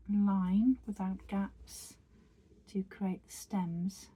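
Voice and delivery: a calm whisper guiding the stitches. Acted. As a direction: murmur each step gently, like close-up ASMR. Whispered tutorial walkthrough.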